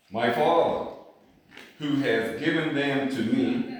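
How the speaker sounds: loud, punched sermon delivery; a pastor proclaiming God's promise with fire; full-force preaching. Acted. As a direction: drive the promise home to the congregation.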